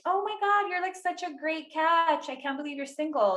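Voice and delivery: high-pitched imitation of gushing admirers; mock gushing. People gushing that she is single.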